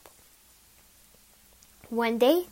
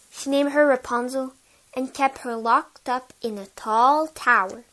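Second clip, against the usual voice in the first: usual speaking voice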